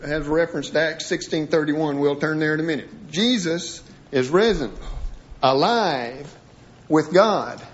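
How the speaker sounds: ordinary talk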